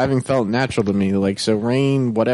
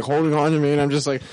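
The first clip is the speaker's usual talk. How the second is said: ordinary talk, nothing marked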